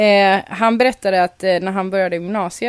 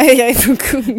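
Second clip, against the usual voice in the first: sheepish laughing confession; 4 semitones higher, 7 dB louder than usual. Retold or imitated. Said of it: "dra svansen mellan benen".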